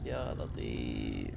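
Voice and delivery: very low, very slow "يا لطيف"; deep slow groan. Pretend. Gamer reacting to his fall, deep dragged groan.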